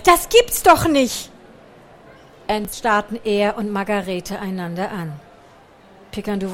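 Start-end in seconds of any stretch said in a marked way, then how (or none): 0.0-1.3 higher, much louder, slow panicked cry; shrill panicked shriek. A narrator's reading. The shop owner spotting mice, shrieking in panic. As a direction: shriek in horror. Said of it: "Ein spitzes, panisches".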